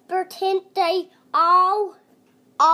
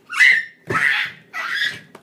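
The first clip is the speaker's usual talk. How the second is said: giggle; 6 dB louder than usual. Real, not acted. Kid laughing after flying play.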